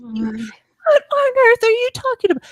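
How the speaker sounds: loud, high-pitched outraged protest; mock-outraged father putting his foot down; indignant dad. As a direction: blurt it out, scandalized and rising. Retelling dad's indignant refusal.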